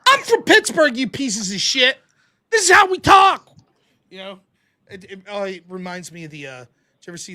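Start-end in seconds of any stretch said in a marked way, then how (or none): 0.1-3.5 high, loud yell in playful outrage; loud, high-pitched mock tirade; mock indignant shout. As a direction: holler it in hammed-up hometown defiance. Mock outburst defending his accent.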